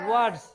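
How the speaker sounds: ওয়ার্ডস with the final s said as a clear z; ওয়ার্ডস ending in a voiced z; z sound on s. Shown as a demonstration. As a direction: stress the buzzing z ending of ওয়ার্ডস. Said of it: "হবে কি?".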